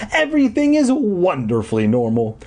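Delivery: high, elated emphasis on "wonderfully". A narrator's reading. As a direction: start EVERYTHING high and lean joyfully on "wonderfully". Elated repeat to herself.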